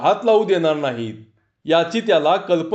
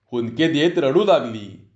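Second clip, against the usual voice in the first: ordinary delivery, nothing marked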